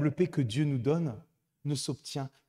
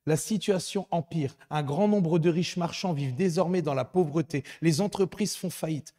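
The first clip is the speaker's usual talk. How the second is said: loud, higher letter reading; preaching at full force; 4 semitones higher, 6 dB louder than usual. Acted.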